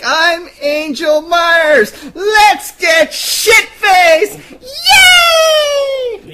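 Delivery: very high, slow, loud cheer; drunk party squeal. Retold or imitated. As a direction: squeal it like a wild party girl. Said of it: "She's like".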